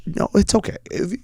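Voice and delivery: high, soft voice with a frog in the throat; trying not to cry. Retold or imitated. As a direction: imitate a child choking back tears. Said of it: "She was trying not to cry".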